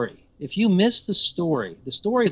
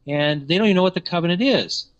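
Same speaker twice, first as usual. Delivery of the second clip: normal talking voice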